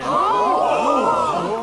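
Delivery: sudden loud breathy oh; mock surprised oh. Dad feigning shock to his son, loud and breathy. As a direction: blurt it like a startled gasp.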